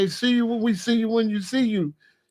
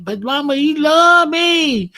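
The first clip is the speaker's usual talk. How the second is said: higher and louder, laughing through a mock girlfriend plea; mock young girlfriend; 9 semitones higher, 8 dB louder than usual. Grandpa mimics a young girl defending him to her mama, laughing. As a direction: play the smitten girl pleading with mama.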